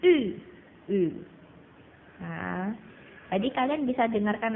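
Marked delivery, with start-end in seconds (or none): 0.1-0.2 I through rounded lips, dropping sharply; forceful falling ü for I. Shown as a demonstration. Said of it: "mulutnya dimajuin kayak kita baca U".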